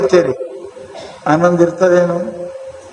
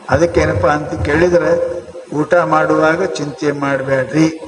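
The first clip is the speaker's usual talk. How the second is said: ordinary talk, nothing marked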